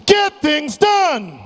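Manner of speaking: slow, high rallying shout; shout with conviction. Shown as a demonstration. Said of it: "Say this with conviction".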